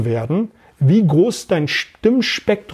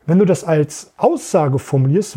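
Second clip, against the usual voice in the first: ordinary delivery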